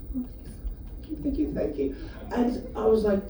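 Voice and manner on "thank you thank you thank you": soft, high, apologetic whisper; hushed high repeated thanks; sheepish thank you. Retold or imitated. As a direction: whisper it like an embarrassed stranger. Apologetic woman at window.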